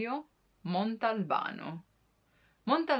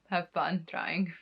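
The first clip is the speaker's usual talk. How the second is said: usual speaking voice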